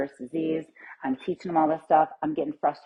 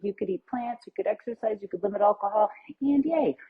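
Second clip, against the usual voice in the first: higher, lightly singsong mock of timid advice; mocking tiptoeing advice; 3 semitones higher than usual. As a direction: wave it off with a dismissive mock cheer. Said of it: "They're like".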